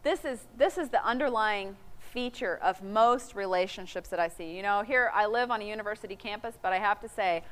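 ordinary delivery

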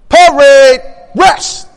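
loud, drawn-out parade-ground order; yelled command. Retold or imitated. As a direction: yell it out to a whole battalion. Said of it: "he yells out to the whole multitude".

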